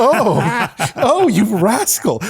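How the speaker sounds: high, slow, fluttery and flattered; flustered coy voice. Retold or imitated. To the flirting plumber, a high flustered coy reply. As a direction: play it coy, swooning at the compliment. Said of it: "I was just like".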